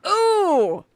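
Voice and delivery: high, loud delighted ooh; excited ooh. Real, not acted. Host hearing the story topic, excited. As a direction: perk up with delight.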